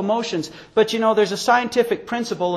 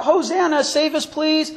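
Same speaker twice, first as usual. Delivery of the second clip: high-pitched cry without shouting; imitated crowd cry; 9 semitones higher than usual. Preacher voices Palm Sunday crowd greeting the Savior, high imitated cry. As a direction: mimic a crowd calling out in praise. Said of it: "cried out".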